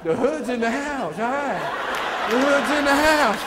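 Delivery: loud, high hype shout; loud, high call to hometown fans; excited shout-out. Real, not acted. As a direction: hype up the Chicago crowd. Hyping crowd.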